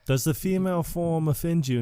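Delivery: lightly hammed-up lady's voice; Maud. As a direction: imitate a woman asking a pointed question. Plays Maud questioning the Dude.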